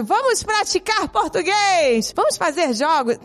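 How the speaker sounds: mock-cheerful parent pushing games; cheery coaxing mom. Mother coaxing her daughter to practise Portuguese, hammed-up cheerful voice. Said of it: "a mamãe, é, incentiva".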